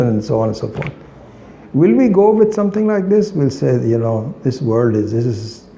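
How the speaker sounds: ordinary delivery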